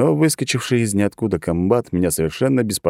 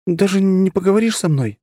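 put-on higher, pleading young woman; woman's voice; 13 semitones higher, 3 dB louder than usual. A narrator's reading. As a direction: coax him to talk.

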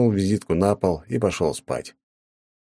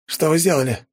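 agitated; 10 semitones higher than usual. A narrator's reading. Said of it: "раздался взволнованный голос".

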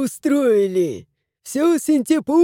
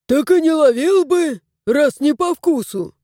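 mildly indignant retort, pitched up; higher, exasperated comeback; 4 semitones higher, 4 dB louder than usual. A narrator's reading. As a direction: protest with a touch of outrage. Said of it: "Возмутился домовой".

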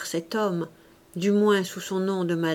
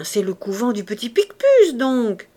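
put-on louder, emphatic old gardener's answer; obvious-fact exclamation; 2 semitones higher, 6 dB louder than usual. A narrator's reading. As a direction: blurt it out as common knowledge. Old man states the obvious.

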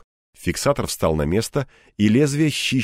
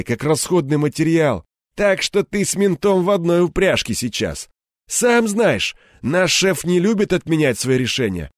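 higher-pitched, taunting boss voice; bald gang boss, wry; 7 semitones higher than usual. A narrator's reading. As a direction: talk down to the hothead, wryly. Реплики Лысого. (голос главаря, с насмешкой)

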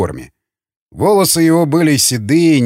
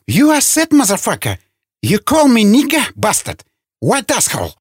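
high-pitched indignant rant; shrieking outrage; 8 semitones higher than usual. A narrator's reading. Insulted man cursing the caged prisoner, shrieking outrage. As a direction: squeal the insults, starting very high. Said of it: "взвизгнув".

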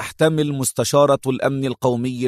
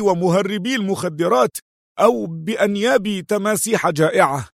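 indignant, raised pitch, moderate volume; angry; 5 semitones higher than usual. A narrator's reading. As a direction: demand answers angrily, voice climbing. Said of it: "لوح بيده قائلًا في غضب".

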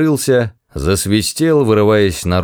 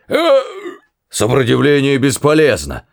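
barking; 5 semitones higher than usual. Acted. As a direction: bark it out. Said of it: "гаркнул охранник".

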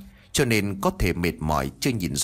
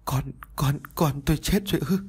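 high, faltering, broken voice; barely getting the words out; stunned grief; 6 semitones higher than usual. A narrator's reading. Mother learning her baby died, stunned and broken.

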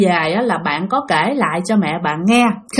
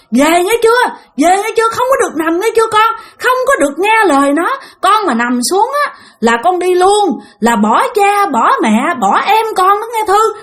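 urgent pleading call; 10 semitones higher than usual. Retold or imitated. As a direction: beg her to come home. Man's spirit pleading girl return.

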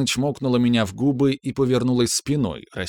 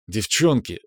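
ordinary delivery

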